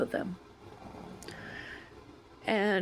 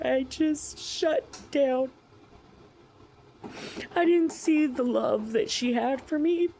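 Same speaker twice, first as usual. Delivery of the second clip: tearful, voice breaking; 5 semitones higher than usual. Real, not acted. Tearful confession of regret.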